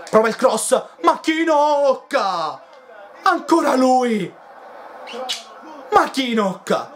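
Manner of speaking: high, drawn-out goal yell; goal shout. Real, not acted. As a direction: scream the scorer's name. Goal scored by Makinok. (urlo da gol)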